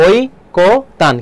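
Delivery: ঐকতান slowly as 'oi... ko... tan'; ও আর রস্বই. Shown as a demonstration. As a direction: break ঐকতান into syllables, opening on oi. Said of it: "ও আর রস্বই হবে". (ঐকতান ভেঙে 'ওই-কো-তান')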